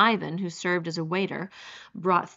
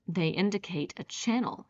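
ordinary delivery

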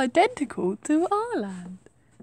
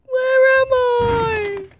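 slow, high, louder plea; dramatic cry; 6 semitones higher, 4 dB louder, much slower than usual. Pretend. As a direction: stretch it out desperately. Second take, louder slow.

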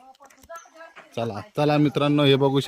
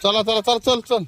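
higher-pitched call to get moving; urging them on; 7 semitones higher than usual. Real, not acted. Vlogger herding friends along, high urging call.

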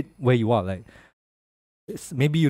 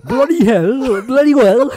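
hammed-up British accent, higher and louder; parodying British speech for a laugh, higher and louder; mock British accent; 10 semitones higher, 9 dB louder, slower than usual. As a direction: put on a British accent for a laugh, pitched up and loud. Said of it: "British language".

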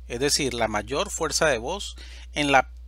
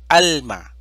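alma dicho fuerte, golpe en AL; ejemplo enfatizado; 9 dB louder than usual. Shown as a demonstration. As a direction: marca fuerte la sílaba AL de alma. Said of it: "También es una palabra, eh, grave". (alma, con fuerza en AL)

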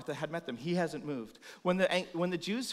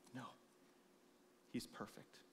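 breathy whispered, much softer; whispered awe; 4 semitones lower, 21 dB quieter than usual. Real, not acted. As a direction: breathe it like a secret. Hushed adoration.

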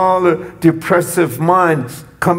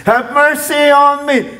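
a high, raised cry for mercy; raised plea; 9 semitones higher than usual. Retold or imitated. Blind man asking Jesus for mercy, raised high. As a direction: lift the voice up to beg. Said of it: "He got it the right way".

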